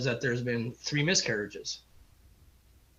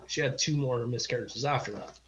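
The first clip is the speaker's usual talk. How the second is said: ordinary delivery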